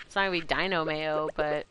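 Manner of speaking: usual speaking voice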